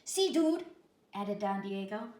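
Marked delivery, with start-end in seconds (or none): 0.0-0.7 high, loud dog voice; chihuahua voice. A narrator's reading. Chihuahua confirming the bully story, loud. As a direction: confirm it gravely.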